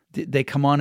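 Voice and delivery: ordinary talk, nothing marked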